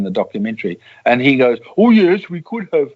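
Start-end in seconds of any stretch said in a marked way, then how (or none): 1.8-2.9 high, loud Swedish lilt; sing-song Swede. Acted. Quoting a man asked about blackmail, sing-song Swedish voice. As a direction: imitate a lilting Swedish voice. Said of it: "sort of a sing-song voice like a Swede".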